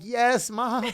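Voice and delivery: excited. Retold or imitated. Retelling call to mother.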